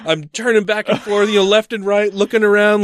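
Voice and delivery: ordinary talk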